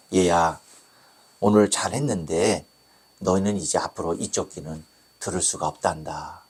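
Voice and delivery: low, soft voice breaking bad news; lightly voiced doctor, low and subdued; grave doctor. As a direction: gently deliver hard news, pitched low.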